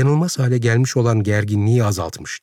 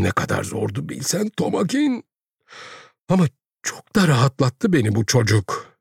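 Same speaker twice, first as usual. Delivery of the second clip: high, plaintive self-defence; tearful pleading; 7 semitones higher than usual. A narrator's reading. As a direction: plead your innocence, slowing in places. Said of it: "Hıçkırarak ağlıyordu". (ağlamaklı, tiz, yalvaran bir sesle)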